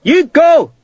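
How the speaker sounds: high, loud command; captain ordering Sharp forward. A narrator's reading. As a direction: send him off with a shout.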